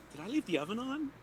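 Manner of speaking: a light, soft imitation of the dwarf's voice; mimicking the dwarf. Bard mimics the dwarf telepathically, a soft, light imitation. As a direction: quietly put on the dwarf's voice in his head. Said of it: "in his voice".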